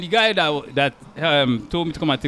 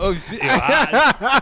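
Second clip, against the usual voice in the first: laughing; 6 semitones higher, much slower than usual. Real, not acted. Laughing reaction to bullying story.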